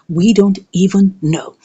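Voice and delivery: usual speaking voice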